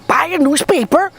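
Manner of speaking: high, loud mock of the senator; high-pitched teasing impression of him. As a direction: put on his scoffing voice, high up. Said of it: "It's like".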